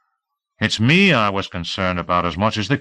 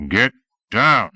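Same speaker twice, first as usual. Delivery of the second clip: demanding; 3 semitones higher, 4 dB louder than usual. A narrator's reading. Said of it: "Orville demanded".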